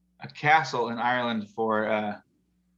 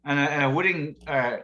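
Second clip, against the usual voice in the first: ordinary talk, nothing marked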